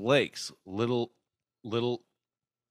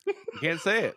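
higher-pitched, giggling protest; laughing in defeat; 5 semitones higher, faster than usual. Real, not acted. Giving up on the Irish wristwatch twister, laughing. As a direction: protest through helpless laughter.

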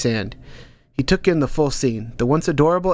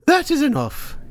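put-on high, sudden old woman's call; sharp old woman. Hilda Dee halting Ank's ordeal, high and sharp. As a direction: snap him out of his daze. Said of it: "Hilda Dee's voice shocked him".

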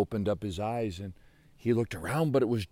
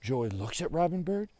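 usual speaking voice

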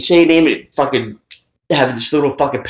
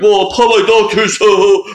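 Santa voice; 4 semitones higher, 3 dB louder than usual. Retold or imitated. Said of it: "And he's like".

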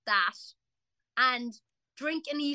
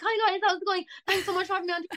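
bright, higher, rushed nervous greeting; nervous gushing; 9 semitones higher, faster than usual. Retold or imitated. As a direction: gush at the lads like a flustered fan. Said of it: "I'm nervous, I'm like".

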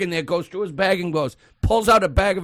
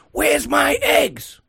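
high, drawn-out mock outrage; losing it; 11 semitones higher, much slower than usual. Older customer to young cashier, losing it. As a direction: imitate an indignant customer demanding an answer. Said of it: "he loses it on this girl".

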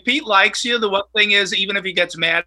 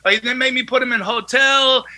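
put-on Lebanese accent; 6 semitones higher than usual. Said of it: "He was like".

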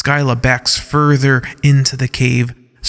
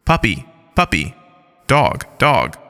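computer voice. Acted. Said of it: "the cave responds".

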